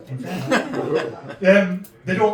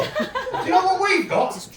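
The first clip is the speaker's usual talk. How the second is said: high, slower laughing yell; comic shout; 14 semitones higher, 4 dB louder, slower than usual. Real, not acted. Telling the young audience what his age brings, shouting.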